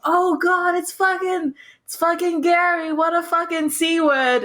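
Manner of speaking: high-pitched, teasing retold exclamation; jovial mock greeting. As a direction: hail a mate with mock dismay.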